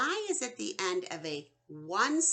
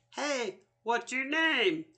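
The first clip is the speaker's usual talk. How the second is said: higher playful call "Hey, what's your name?"; voicing the letter E; 5 semitones higher, 4 dB louder than usual. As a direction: lightly voice a friendly letter E. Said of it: "where the E says".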